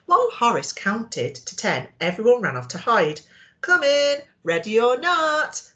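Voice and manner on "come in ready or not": higher, louder playful call; mild excited shout. Acted. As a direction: call out gleefully to the hiders. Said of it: "called Horace excitedly".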